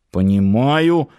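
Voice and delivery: usual speaking voice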